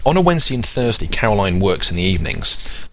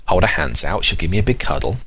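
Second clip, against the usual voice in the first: usual speaking voice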